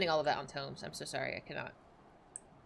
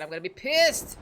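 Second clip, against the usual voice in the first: a sudden louder half-joking rant; mock angry outburst; 6 dB louder than usual. Real, not acted. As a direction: snap it out like you're fed up with the music. Mock threat about sad music.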